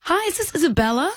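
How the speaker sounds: high, put-on professional caller; bright fake HR voice; Claire Martin voice. Shown as a demonstration. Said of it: "Give us just a little bit of a taste of your Claire Martin voice".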